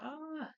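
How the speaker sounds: high-pitched "ah"; drawn-out high yelp; imitated cry. Retold reaction in film scene.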